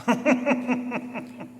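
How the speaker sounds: short eerie mocking cackle; sinister laugh. The Shadow mocking the three victims, a short eerie cackle.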